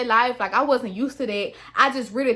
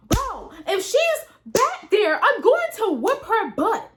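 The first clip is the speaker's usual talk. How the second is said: tough threat; 9 semitones higher than usual. Retold or imitated. Retelling her own threat.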